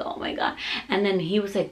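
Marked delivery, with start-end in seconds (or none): 0.0-0.6 soft whispered plea; exhausted. Retold or imitated. Worn-out laboring mom answers partner, exhausted whisper. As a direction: whisper it, all energy spent. Exhausted reply to his urging.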